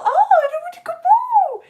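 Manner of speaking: very high, loud, drawn-out wail; squealing toy character. Acted. Teacher voicing Celeste the red blood cell, high wail.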